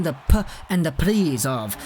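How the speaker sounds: "P" as a short puh, then "Prizov" apart; separated syllables. Shown as a demonstration. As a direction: show the break before "Prizov". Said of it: "there's a little break there".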